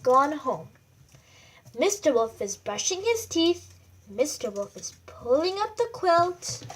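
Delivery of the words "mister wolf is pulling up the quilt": ordinary delivery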